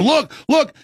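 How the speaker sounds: high-pitched mock crew alert; retold urgent call. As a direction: imitate a crew urgently pointing something out. Retold crew urgently calling attention.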